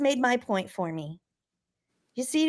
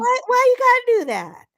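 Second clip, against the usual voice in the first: exasperated; 10 semitones higher, 4 dB louder than usual. Real, not acted. Repeats protest louder.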